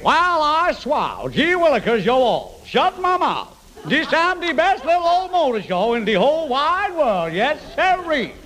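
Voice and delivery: put-on high nasal Southern American accent with a peg on the nose; hammed-up American accent through a pinched nose. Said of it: "Don't forget to speak with an American accent".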